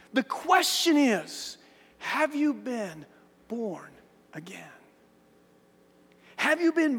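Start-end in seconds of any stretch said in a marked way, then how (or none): 2.0-4.6 fading to a hush. Acted. Preacher repeating the key question, fading to a near hush. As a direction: have you been born again, slow, dropping softer until born again is near silent.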